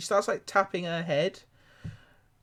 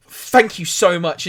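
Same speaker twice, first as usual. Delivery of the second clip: effusive thanks; 4 semitones higher, 10 dB louder, slower than usual. Acted. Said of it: "shaking it, like, wildly".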